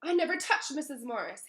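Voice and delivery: put-on a boy protesting his innocence aloud; said loudly. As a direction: insist loudly you're innocent. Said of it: "Harry said loudly".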